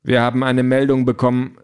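put-on officer's voice; police officer. A narrator's reading. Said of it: "sagt der Polizist".

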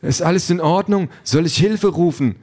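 high-pitched worried AI companion; alarmed digital boyfriend. A narrator's reading. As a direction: call out anxiously, high. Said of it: "sagt die Figur auf dem Monitor".

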